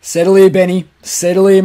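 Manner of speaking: usual speaking voice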